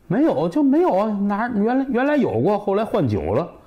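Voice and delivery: high, exasperated excuse; defensive protest. Acted. Host explains to guest the stove went for drink, high defensive protest.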